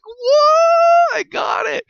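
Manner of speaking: very high, loud yelp; excited shout. Retold or imitated. Gamer shouting at the interception, very high yelp. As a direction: shriek it in triumph. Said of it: "And I was like".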